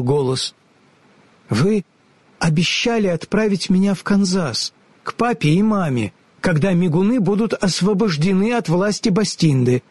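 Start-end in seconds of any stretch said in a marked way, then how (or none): 1.5-9.8 put-on high, earnest young girl; girl's voice. A narrator's reading. Ellie asks the wizard to keep his promises, earnest. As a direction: ask politely like a brave little girl.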